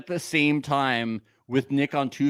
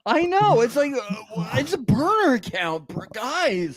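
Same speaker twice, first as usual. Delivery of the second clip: mock insistence; 6 semitones higher than usual. Mocks being hung up on Vince.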